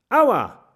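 high loud ouch; pained cry. Acted. Mathilda crying out falling into the pit. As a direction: yelp as you land hard. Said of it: "schreit Mathilda".

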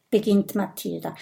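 plain reading voice

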